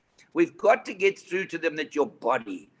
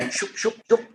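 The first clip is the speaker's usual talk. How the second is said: punchy, higher "shoop" sound effects; imitated hip-swing noise; 6 semitones higher, 5 dB louder than usual. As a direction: act out swinging hips with loud whooshes. Mimics [name]'s hip moves.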